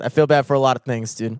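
ordinary talk, nothing marked

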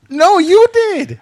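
high, drawn-out indignant shout; loud protest. Acted. Accused of letting him back, loud high protest. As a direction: throw the blame back at full volume. Mock quarrel over who let guest in.

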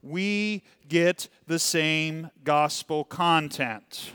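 slow, deliberate unison line. Shown as a demonstration. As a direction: lead the room through it word by word, x0.5. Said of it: "say it with me".

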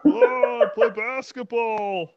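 overblown, dopey parody of an athlete; goofy player impression. Friends mocking a player.

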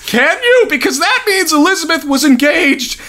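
incredulous. Real, not acted. Outraged at child engagement maths.

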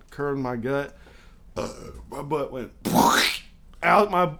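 ordinary delivery

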